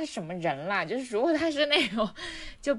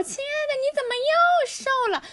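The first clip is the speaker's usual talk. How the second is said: much higher, gushing fake sweetness; gushing coworker voice; 4 dB louder than usual. Coworker fakes a compliment, high gushing voice. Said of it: "然后他就说".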